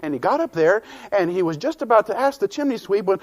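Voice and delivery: usual speaking voice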